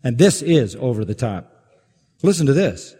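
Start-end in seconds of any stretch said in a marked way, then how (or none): none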